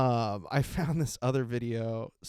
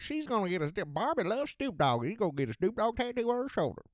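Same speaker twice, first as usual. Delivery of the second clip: mock Mississippi anchor, higher and fast; Mississippi newscaster; 9 semitones higher, faster than usual. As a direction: rattle it off like a Mississippi anchor, x1.6 speed. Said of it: "that's just how fucking newscasters sound in Mississippi".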